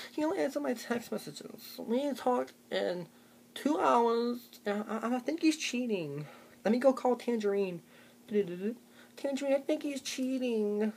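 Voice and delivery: high, soft, slow whine; whiny suspicious girlfriend. Retold or imitated. Girlfriend telling her friend he's cheating, high soft whine. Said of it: "she's like".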